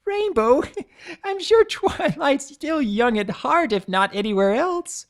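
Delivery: high, giggling jab; chuckling while she teases; laughing tease. Acted. Pinkie laughing at Twilight.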